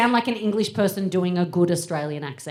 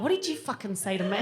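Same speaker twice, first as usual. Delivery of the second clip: mock outrage; 4 dB quieter, faster than usual. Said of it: "I'm like".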